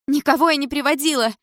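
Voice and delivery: mother shrieking back at the stepfather; loud hysterical reply. A narrator's reading. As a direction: scream it back, near hysterics. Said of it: "так же громко отвечала мама".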